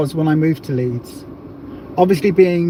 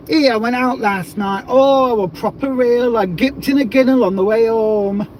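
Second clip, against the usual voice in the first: put-on higher, broad Yorkshire accent, telling a night out; 4 semitones higher than usual. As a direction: ham up a Leeds local's Yorkshire accent. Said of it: "you gotta do it in a Yorkshire accent".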